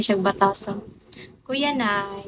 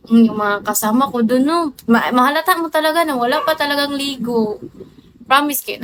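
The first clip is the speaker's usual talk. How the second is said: usual speaking voice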